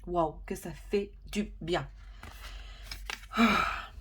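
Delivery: long relieved exhale; blowing out hard. Real, not acted. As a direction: blow the air out audibly. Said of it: "vous m'entendez souffler".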